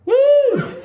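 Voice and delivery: very high "woo"; whoop. Real, not acted. Comic breaking off mid-sentence on stage, high whoop. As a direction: let out a high-pitched whoop.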